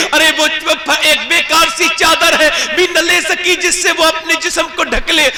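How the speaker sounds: high, loud impassioned sermon; fervent preaching. Acted. Preacher scolding the daughters of today, fervent and loud. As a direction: rebuke the audience with rising fervour.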